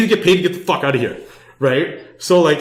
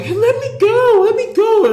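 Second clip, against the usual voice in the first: mock girl's plea; 3 dB louder than usual. Girl grabbed on stage by drunk guy, high mock pleading. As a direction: squeak it out like a frightened girl. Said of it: "And the girl's like".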